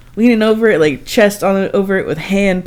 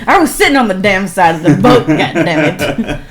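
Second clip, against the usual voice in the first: much louder defiant insistence; defiant outburst; 2 semitones higher, 8 dB louder than usual. Real, not acted. Wife defending her seat to husband, louder defiant outburst. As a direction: stake your claim loudly. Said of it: "I wasn't able to ... pregnant".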